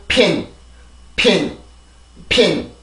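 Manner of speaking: "pin" as a separate, falling model word; pronunciation drill. Shown as a demonstration. Teacher showing how "in" becomes "pin", pronunciation drill. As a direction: say "pin" with an initial p puff, then drop the pitch. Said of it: "이렇게 발음이 되고".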